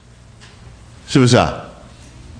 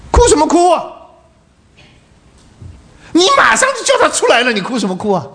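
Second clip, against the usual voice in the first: mock rebuke. Mock rebuke of Jesus crying.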